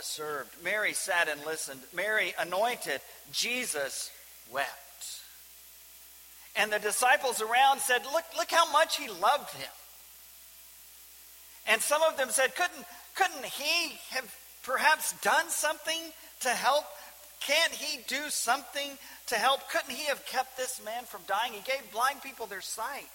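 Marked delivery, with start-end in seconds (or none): 3.3-5.2 slow reverent. Real, not acted. Preacher repeats the verse, lingering. 8.1-23.1 questioning disciples. Acted. Disciples voiced questioning Jesus.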